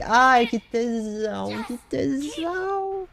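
high, slow hammed-up moan of delight; mock ecstasy. Gamer celebrating a clean escape, hammed-up delight. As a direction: ham up the pleasure, stretching it out.

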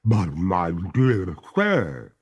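slow, reluctant ghost voice. A narrator's reading. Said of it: "with a pale reluctance".

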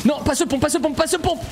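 high, urgent repeated plea; panicked pleading. Real, not acted. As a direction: plead in a rush of gamer panic. Panique face au pompe adverse. (supplication paniquée, voix aiguë)

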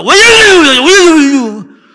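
very high, blaring imitated tongues; loud prayer. Boy praying in tongues though silence was ordered, very loud. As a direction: belt out babbling prayer at full volume. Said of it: "큰 소리로 하는 거야".

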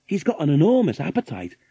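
usual speaking voice

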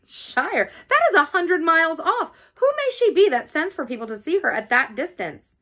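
startled master objecting to a long journey; astonished outburst. A narrator's reading. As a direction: throw the question out in disbelief. Rochester astonished at the distance.

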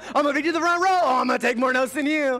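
high, loud, gushing Sunday excitement; eager worshipper. Retold or imitated. Preacher mimicking true worshipers racing to the front row, high and loud. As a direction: burst out like a churchgoer who can't wait for service. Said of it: "True worshipers will be".

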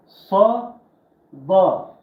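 Sa said thick and loud, tongue pulled back; heavy sa. Shown as a demonstration. Teacher demonstrating the thick Arabic letter sa. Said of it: "Sa kalın".

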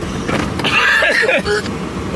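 rough unvoiced coughing fit; choking cough. Real, not acted. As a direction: cough hard as the spice catches. Coughing after spicy food.

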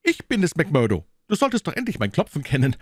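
high, quick fussy gentleman's voice; a fretful little man insisting at the gate; Thaddeus, high and hurried; nervous gentleman. Acted. Thaddeus Sholto speaks at the gate.

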